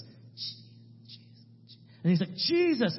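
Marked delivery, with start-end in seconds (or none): 0.0-1.8 barely audible, voiceless broken attempts; mute man struggling. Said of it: "starts like this". 2.3-3.0 high-pitched imitated breakthrough cry; getting louder. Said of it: "he gets louder and louder".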